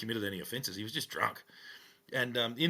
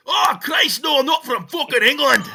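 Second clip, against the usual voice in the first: furious high shout in a mock Scottish voice; big mad Scotsman; 18 dB louder than usual. Drunk man asked by police if he's English, outraged Scottish bellow. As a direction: explode at the question like an outraged Scotsman. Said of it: "This big mad Scotsman".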